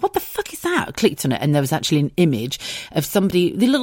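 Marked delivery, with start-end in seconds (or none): 0.0-0.9 shocked inner thought. Retold or imitated. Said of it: "I thought".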